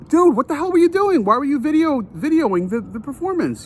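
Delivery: high-pitched retold outrage, stumbling over words; indignant confrontation. Narrator confronts man videoing the performance, re-enacted indignation.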